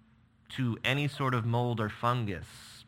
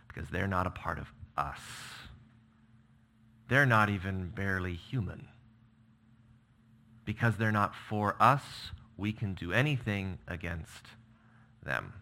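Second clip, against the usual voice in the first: ordinary talk, nothing marked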